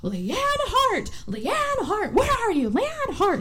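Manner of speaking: high, drawn-out calling out; mock screaming. Unpaid crew storming the studio for Leanne, mock screaming. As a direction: imitate angry men yelling, without full volume. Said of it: "come in and start screaming".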